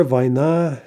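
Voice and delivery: "vaina" with stress on the final NA; end stress. Shown as a demonstration. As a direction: say vai-NA for "vaina". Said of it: "in stress".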